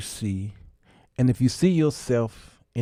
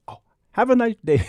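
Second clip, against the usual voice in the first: mock Pakistani accent; 7 semitones higher, faster than usual. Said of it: "they talk like this".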